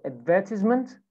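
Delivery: British "advertisement", stressed on "VER"; British accent. Shown as a demonstration. Teacher models British "advertisement" for students, stress on second syllable. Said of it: "British accent".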